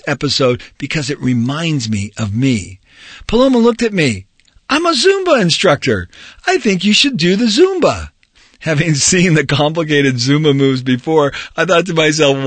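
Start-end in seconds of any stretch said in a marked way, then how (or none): 4.7-8.1 higher female voice. Acted. Narrator voices Paloma.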